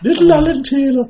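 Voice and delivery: very high, squeaky little gingerbread man voice; high Northeast gingerbread man. Retold or imitated. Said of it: "my impersonation of, uh, a character called Alan Taylor".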